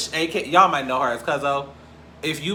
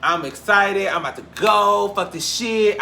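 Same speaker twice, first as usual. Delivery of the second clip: mock bravado; 6 semitones higher, 5 dB louder, slower than usual. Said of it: "I was like".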